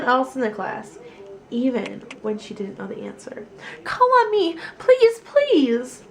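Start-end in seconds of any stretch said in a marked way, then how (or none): 3.8-6.0 put-on high, loud pleading child; eager begging. Lily begs the teacher to call on her, high and loud. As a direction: bounce in your seat begging to be picked.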